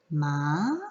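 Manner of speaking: "Ma" climbing like a question; rising second tone. Shown as a demonstration. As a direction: ask "Ma" as a question, pitch gliding up. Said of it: "almost like you're asking a question".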